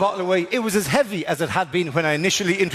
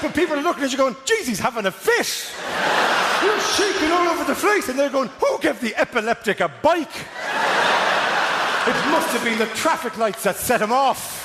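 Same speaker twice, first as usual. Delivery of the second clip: manic high retelling; 10 semitones higher than usual. Pretend.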